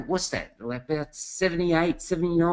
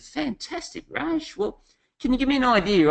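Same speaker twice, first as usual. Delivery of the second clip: much higher, bright eager surprise; mock cheery delight; 12 semitones higher than usual. Passenger greeting the taxi driver, high excited delight. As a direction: gush at the cabbie like you're thrilled.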